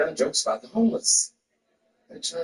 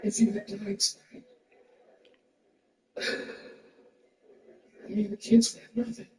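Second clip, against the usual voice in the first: higher, mock-tearful wife with halting pauses; imitated crying wife; 6 semitones higher than usual. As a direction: act the wife upset, breaking off. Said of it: "And she was crying".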